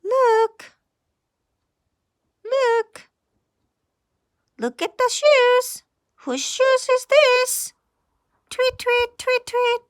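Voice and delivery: high, light bird voice chirping; little bird voice. Acted. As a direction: play a curious little bird.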